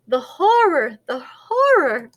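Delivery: high, loud storybook dismay; melodramatic horror. A narrator's reading. Narrator voices a turkey watching the bird get beaten, melodramatic horror. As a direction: cry out in drawn, mock horror.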